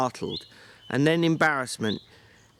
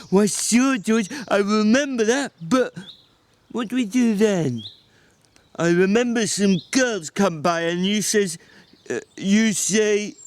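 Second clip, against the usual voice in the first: put-on higher, childlike eagerness; Lenny proudly recalling for George; Lenny, eager and simple; 10 semitones higher, 5 dB louder than usual. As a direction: brighten up like a child who remembers.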